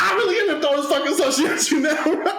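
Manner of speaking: high-pitched mock threat, laughing; hammed-up outrage tipping into laughter; retold heated threat. Acted threat to throw the drink.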